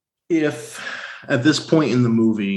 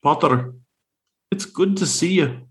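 Scottish brogue; 3 semitones higher than usual. Retold or imitated. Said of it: "I'll do it in the brogue".

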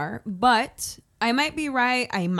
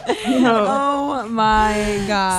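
slow, louder drawn-out oh my God; drawn-out disbelief; 6 dB louder, much slower than usual. Real, not acted. Cohost reacting to wanting a head hit, slow disbelieving. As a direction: stretch it in disbelief.

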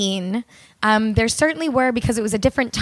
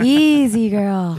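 louder playful horse-calming call; soothing a horse; 4 dB louder, much slower than usual. Pretend. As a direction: call out to calm a skittish horse.

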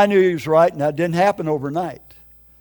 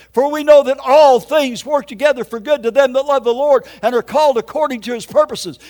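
high, loud verse recitation; raised, driving pulpit delivery; preaching scripture; 6 semitones higher, 5 dB louder than usual. Real, not acted. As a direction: declaim the verse with force.

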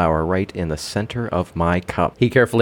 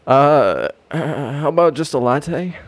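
flustered stammer, pitched up; mild panic; 8 semitones higher than usual. Acted. Nervous customer flustered by the barista, stammering. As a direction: stumble out the order in a fluster. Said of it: "I panic".

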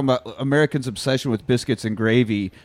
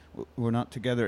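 quieter, trailing off; deflated mumble; 2 semitones lower, 9 dB quieter than usual. Retold or imitated. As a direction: say it flatly, a bit embarrassed. Nicholas dejected, repeated scene.

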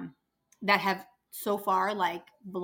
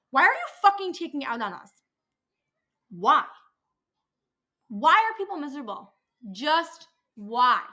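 exasperated rant; 4 semitones higher, 6 dB louder than usual. Real, not acted. Venting about rude patient.

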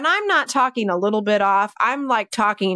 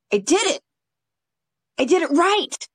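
higher, triumphant little boast; proud dog's voice; 8 semitones higher than usual. Retold or imitated. Handler voicing her dog after the jump, high and proud. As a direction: crow it for the dog, high-pitched.

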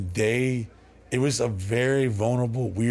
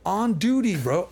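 incredulous; 9 semitones higher, 4 dB louder than usual. Real, not acted. Incredulous emphasis on cop's behavior.

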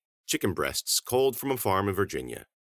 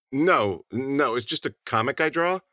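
flustered denial; 7 semitones higher, 4 dB louder than usual. A narrator's reading. Milo caught out, hastily denying.

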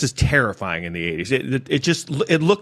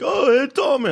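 much higher sing-song put-on greeting; imitating the woman. Narrator mimics the woman greeting him on the street, much higher mock voice. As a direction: chirp the hello up high like her. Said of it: "and she's like".

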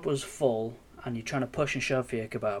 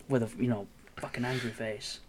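ordinary talk, nothing marked

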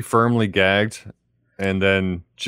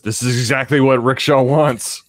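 loud dramatic movie line; 4 semitones higher, 5 dB louder than usual. Retold or imitated. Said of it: "Charge says".

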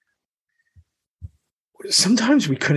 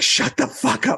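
mock snapped hush; 10 semitones higher than usual. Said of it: "He's like".

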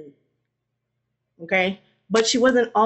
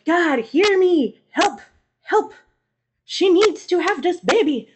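pleading Adam; 8 semitones higher than usual. Retold or imitated. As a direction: beg God for help, voice climbing. Adam begs God during birth.

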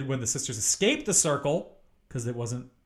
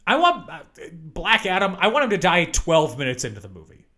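ordinary talk, nothing marked

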